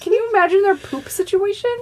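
high fast giggly question; giggling while asking; laughing. Real, not acted. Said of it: "Why are you laughing so hard?".

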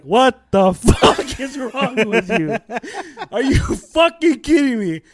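slowly roaring parent tirade with a laugh breaking through; imitating furious dad. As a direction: yell like an enraged father scolding his kid. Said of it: "He was so pissed".

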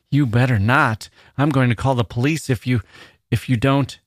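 put-on high, hurried girl's voice, faltering midway; panicky threat. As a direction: blurt the threat in rising alarm. Frightened girl threatens to call police.

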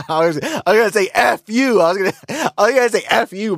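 laughing self-censor. Real, not acted. Laughs while cutting himself off.

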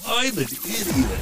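high, slow, drawn-out put-on voice; clean drop take. Shown as a demonstration. Husband records a clean drop for the editor, slow silly voice. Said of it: "get that clean so we can use it as a drop".

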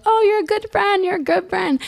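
high, sweet imitation of gushing girls; mock gushing girls. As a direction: lay on the girly gushing. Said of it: "these girls were like".

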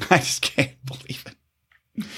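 whispered through a laugh, slow; laughing disbelief. Real, not acted. Host laughing at the story, whispered disbelief. Repeats disbelief after rant.

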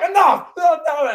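high-pitched, loud referee yell; Earl Hebner. Retold or imitated. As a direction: scold at full shout, imitating a fed-up referee. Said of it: "he's just yelling at him".

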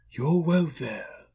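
slow, high, drawn-out spirit voice; high ghostly pronouncement; solemn ghost. Acted. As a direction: answer as an otherworldly spirit, stretched out. Narrator voices the Ghost answering.

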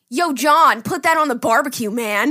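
loud mock-American dude. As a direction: imitate a Brit doing a broad American accent. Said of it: "they always stereotype too".

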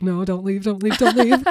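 rising desperate pleading voice. Retold or imitated. Bella pleading Alice to stay.

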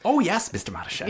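put-on high, quick, eager errand boy voice; nice boy. Errand boy answering his boss, high and obliging. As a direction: pipe up high and fast, ever obliging. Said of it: "Just like a nice boy who's".

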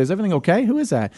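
high, lightly voiced wife asking; a hint of a curious wife; wife's voice. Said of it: "Even Terry's voice".

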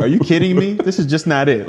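high, exasperated mock coach; scolding coach.

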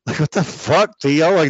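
ordinary delivery

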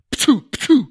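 two sharp, high, loud spitting puffs; blowpipe shots. A narrator's reading. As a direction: imitate darts fired from a blowpipe.

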